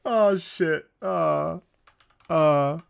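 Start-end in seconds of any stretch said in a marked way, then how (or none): none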